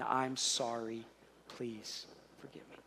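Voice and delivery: slow, low, falling to a near-whisper; humble apology. Acted. Father going back to his kids, hushed and contrite. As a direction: let the plea for forgiveness fade almost to nothing. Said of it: "if I didn't go back and say".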